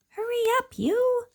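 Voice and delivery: very high, tiny urging voice; squeaky duckling. Acted. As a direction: hurry them along like a little duckling.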